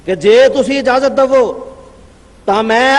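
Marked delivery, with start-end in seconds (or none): none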